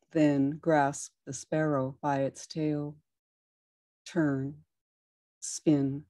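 measured, unhurried poem reading; calm reading of a poem; poetry reading. Acted. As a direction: read each movement name like a gentle instruction. Reads Tai Chi poem aloud.

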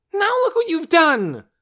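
put-on very high, loud child's scream; screamed accusation. A narrator's reading. Torin's shirt is covered in egg, screamed accusation. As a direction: scream in outraged blame. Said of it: "Torin screamed".

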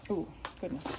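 ordinary talk, nothing marked

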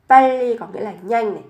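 usual speaking voice

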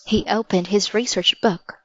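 usual speaking voice

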